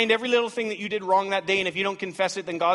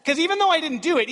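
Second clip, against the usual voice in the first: usual speaking voice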